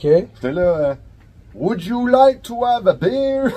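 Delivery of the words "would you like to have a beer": high, slow, hammed-up French accent in English; mock French barman offering a beer; exaggerated French accent. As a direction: lay the French accent on thick for laughs. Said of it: "Je faisais exprès d'exagérer mon accent".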